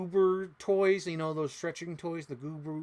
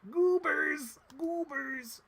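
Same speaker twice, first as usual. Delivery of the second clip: much higher goofy shout of the name; silly cartoon voice; 13 semitones higher, 3 dB louder, much slower than usual. Shows viewers the Goober toys, goofy high voice. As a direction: squeal it like a cartoon.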